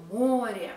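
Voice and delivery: море with a loud, long "MO"; stressed o. Shown as a demonstration. Teacher repeating the example, море as "MO-rye". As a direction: hit the first syllable of море. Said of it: "we pronounce it o".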